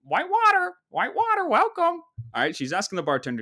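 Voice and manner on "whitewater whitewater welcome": high, loud squawking bird voice; hammed-up talking macaw greeter; animatronic macaw. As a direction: squawk a welcome like a mechanical parrot. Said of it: "A big animatronic macaw".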